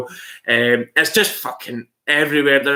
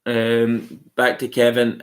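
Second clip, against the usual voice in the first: ordinary delivery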